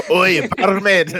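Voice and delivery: put-on forced Scottish accent. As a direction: hail someone in a put-on Scottish brogue. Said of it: "thing I can say in a forced".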